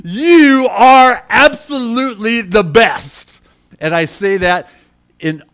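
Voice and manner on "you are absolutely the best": loud, slow, soaring praise to God; joyful praise. Retold or imitated. Pastor reliving his loud joyful praise to God after the clutch failed. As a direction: shout thanks to the Lord with joy. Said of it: "started laughing and smiling and praising God and saying".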